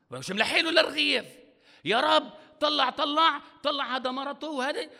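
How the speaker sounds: high, loud whining prayer; mocking a self-pitying believer's prayer; whiny complaint. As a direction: whine to God like a hard-done-by believer.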